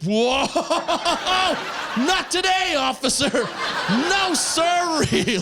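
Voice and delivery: high, drawn-out comic backing off; mock alarmed refusal. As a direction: whoop it way up high, laughing it off. Retold reaction to a cop.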